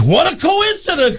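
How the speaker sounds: very high, hammed-up exclamation; acted excited amazement. Woman exclaims at farmer's confession, hammed-up excited amazement. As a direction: squeal it as the punchline.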